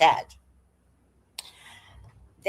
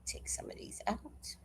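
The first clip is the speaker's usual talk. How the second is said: whispered aside; 20 dB quieter than usual. Real, not acted. Whispering to herself while editing.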